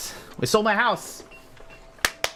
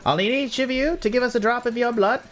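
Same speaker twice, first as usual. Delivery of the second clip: high character voice explaining procedure; guard spelling out the rules, pitched up; officious guard; 7 semitones higher than usual. Acted.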